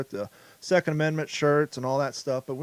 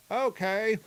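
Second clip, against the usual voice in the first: high sidekick voice; 7 semitones higher than usual. Pretend. Sidekick answers in skit.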